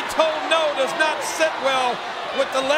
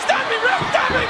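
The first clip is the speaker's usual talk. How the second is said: high-pitched urgent cry; alarmed plea; 8 semitones higher than usual. Real, not acted. Commentator calling to the referee as an attack looms, alarmed. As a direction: plead urgently for someone to step in.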